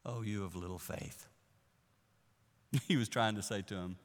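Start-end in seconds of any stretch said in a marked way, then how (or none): 0.1-1.3 soft, low, gentle rebuke; a quiet sigh of mild disappointment; lightly voiced Jesus. Jesus chiding the disciples, softly and low. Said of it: "he said the line".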